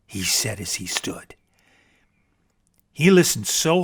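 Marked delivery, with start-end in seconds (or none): none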